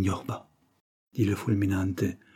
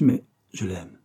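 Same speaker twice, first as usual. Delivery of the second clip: usual speaking voice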